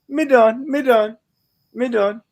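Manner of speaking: high, loud mock of his sister; imitating sister Nine. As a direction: squeal it like his sister quitting. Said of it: "that's my sister Nine right there".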